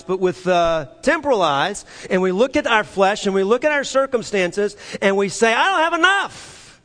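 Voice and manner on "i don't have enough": high-pitched mock complaint; complaining. Preacher imitating people looking at circumstances, complaining. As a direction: wail it high, imitating a worrier.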